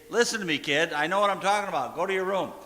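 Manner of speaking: acted parental scolding at high volume; stern parent. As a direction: lay down the law, loud and fast in the middle. Acting out an overbearing parent's order.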